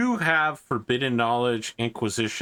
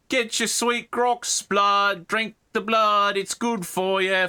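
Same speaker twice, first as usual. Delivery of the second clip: much higher, slow hawker calling out wares; market hawker; 11 semitones higher, much slower than usual. Acted. Meat seller calling to the crowd, high slow hawker's cry. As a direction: cry out meat like a street vendor. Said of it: "someone tentatively shouting out".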